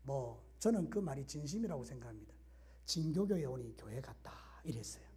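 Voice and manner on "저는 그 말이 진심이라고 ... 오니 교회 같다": hushed retelling. Retold or imitated. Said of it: "그분이 이런 이야기를 했어요".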